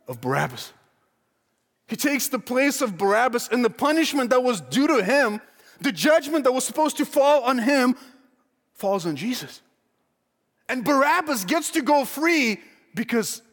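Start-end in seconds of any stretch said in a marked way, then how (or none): 1.9-13.4 fervent preaching. Acted. Preaching peak.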